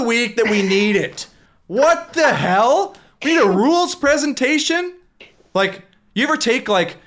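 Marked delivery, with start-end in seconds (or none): 1.7-4.9 incredulous. Real, not acted. Incredulous outburst at rules session.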